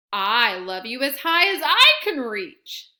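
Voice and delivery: fond grown-up echo, bigger and bolder; big hare topping the little one's reach. Acted.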